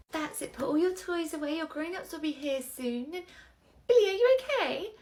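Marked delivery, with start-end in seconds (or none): none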